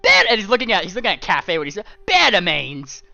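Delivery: husband outraged at the guests; outraged shout. Acted. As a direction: bellow the name in disgust. Dragon outraged exclamation.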